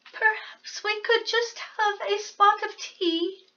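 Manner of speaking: a tiny mouse politely angling for tea; polite little mouse. Acted. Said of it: "said the mouse".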